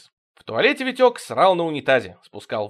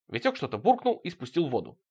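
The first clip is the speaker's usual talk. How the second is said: ordinary delivery, nothing marked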